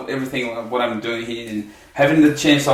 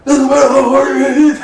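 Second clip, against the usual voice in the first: high, loud mock movie-tough-guy line; playful Stallone imitation; 10 semitones higher, 6 dB louder than usual. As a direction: ham up a Rocky quote. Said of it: "the same accent ... Sylvester Stallone thing".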